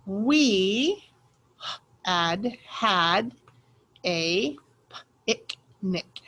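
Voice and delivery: slow word by word reading; sounding out words. Shown as a demonstration. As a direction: sound out each word with pauses. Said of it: "ready to sound out the words".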